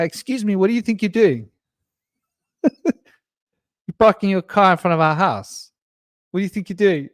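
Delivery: playing the boy telling off the stranger; quoting his son scolding. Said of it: "I hear him saying to the guy".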